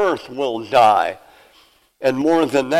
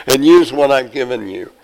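God chuckling. Acted. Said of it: "God says".

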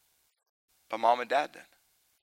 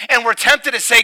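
much louder and higher fervent sermon voice; full-force preaching; 8 semitones higher, 10 dB louder than usual. Acted. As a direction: preach it out to the whole room.

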